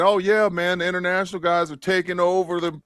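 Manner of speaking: mock hype. Host mimicking fans hyping international players, mock hype. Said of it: "the whole world saying".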